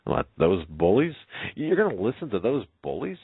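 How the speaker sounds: put-on story girl scoffing, pitch swooping up; incredulous. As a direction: challenge him in disbelief. Girl indignant about the bullies.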